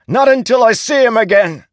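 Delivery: loud, high desperate scream. A narrator's reading. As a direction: scream it out in defiance. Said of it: "he screamed".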